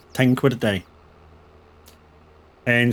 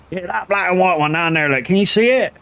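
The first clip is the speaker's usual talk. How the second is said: higher mock farmer voice, rising to the end; imitating the farmer; 6 semitones higher than usual. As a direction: play the vague old farmer pointing it out, pitched up. Farmer voiced pointing at calf.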